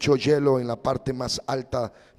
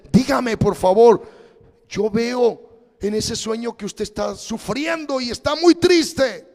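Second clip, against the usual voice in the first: pleading mother; 9 semitones higher, 8 dB louder than usual. Retold or imitated. Mother wakes frightened, pleads.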